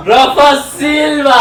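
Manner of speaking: slow, high shout. Real, not acted. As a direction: call the name out long. Shouting a player's name.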